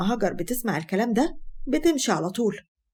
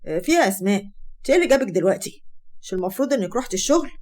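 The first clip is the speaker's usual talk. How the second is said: usual speaking voice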